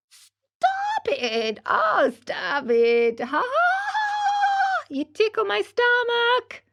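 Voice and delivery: very high little voice laughing while ticklish; giggling tiny voice. Acted. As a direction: giggle out the words as if being tickled. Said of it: "it giggled as it spoke".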